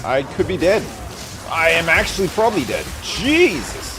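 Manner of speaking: alarmed. Real, not acted. Near death in the game.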